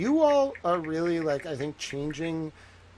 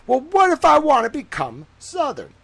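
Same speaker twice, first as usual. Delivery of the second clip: much higher, louder, hammed-up Southern drawl; 11 semitones higher, 9 dB louder than usual. Answering imagined viewers in a mock Southern accent. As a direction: play a playful put-on Southern accent, high and bright. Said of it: "I've also taken on a Southern drawl".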